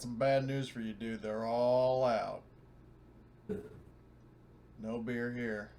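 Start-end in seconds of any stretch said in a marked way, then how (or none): none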